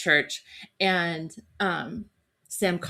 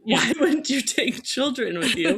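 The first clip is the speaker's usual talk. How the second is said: laughing; 7 semitones higher, 4 dB louder than usual. Real, not acted. Laughing disbelief at husband.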